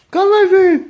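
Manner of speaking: insistent high-pitched shout; shouted dog call. Real, not acted. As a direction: holler for a dog to come.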